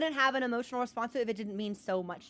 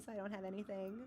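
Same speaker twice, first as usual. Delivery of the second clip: ordinary talk, nothing marked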